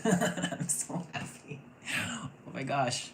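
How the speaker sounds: half-laughed, airy and high; laughing breathless. Real, not acted. Reader overcome with joy, laughing the words airily.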